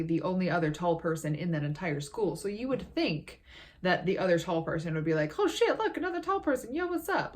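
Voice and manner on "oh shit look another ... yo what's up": very high-pitched, excited mock greeting; imagine spotting one of your own kind, pitched way up; acted-out delighted recognition. Imagined greeting from fellow tall person.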